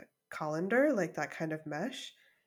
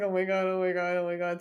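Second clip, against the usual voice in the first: mock panic; 6 dB louder, faster than usual. Retold panic repeating.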